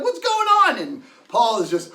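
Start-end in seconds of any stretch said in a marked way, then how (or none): 0.0-0.8 alarmed call. Retold or imitated. Friends come running, alarmed.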